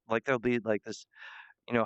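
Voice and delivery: usual speaking voice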